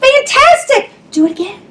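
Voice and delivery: very high excited cry; a princess egging the dragon on; shouted. Acted. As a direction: cheer him on, high and bright. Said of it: "Elizabeth shouted".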